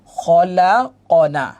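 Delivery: very slow, drawn-out syllables; measured Quran-class sounding-out; joined-up spelling. Shown as a demonstration. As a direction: blend the letters into a slow recitation. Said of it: "সব মিলে কি হলো".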